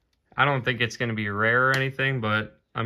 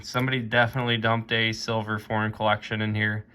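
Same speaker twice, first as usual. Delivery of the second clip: ordinary talk, nothing marked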